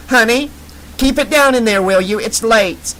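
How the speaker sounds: high-pitched, breathy mom calling through a door; mother calling. Acted. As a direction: call out like a mother from outside. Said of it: "high-pitched, breathy voice of Lola's mother called".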